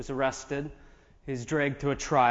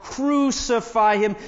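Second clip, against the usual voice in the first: higher, drawn-out cry of condemnation; crowd's cry; 7 semitones higher, 4 dB louder, slower than usual. A narrator's reading. Priests and officers calling for Jesus' death, crying out. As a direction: stretch the demand out, pitched up. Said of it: "they cried out".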